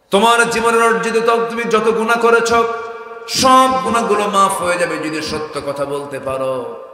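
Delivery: loud, high-pitched sermon cry, easing at the end; full-force preaching. Real, not acted. Preacher promising forgiveness of sins, impassioned loud preaching. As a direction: proclaim it at the top of your voice.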